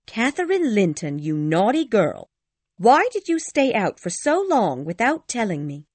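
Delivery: high, loud mock scolding; put-on anger. A narrator's reading. Ellen scolding runaway Catherine, acting angry. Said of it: "she had to act angry".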